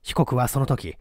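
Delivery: plain reading voice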